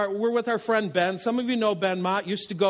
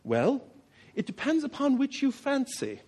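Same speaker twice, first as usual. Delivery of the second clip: put-on British accent, soft and measured; 4 semitones higher, 3 dB quieter than usual. English friend answering a young golfer's club question, British accent. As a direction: imitate a proper British reply, pausing after "well". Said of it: "in this unmistakably British accent and says".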